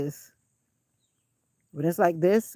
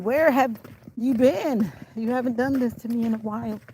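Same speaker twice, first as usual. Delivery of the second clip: a lightly voiced complaining body, higher; voicing complaining legs; 5 semitones higher than usual. Vlogger voicing her sore legs, mild mock scolding. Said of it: "My legs are saying".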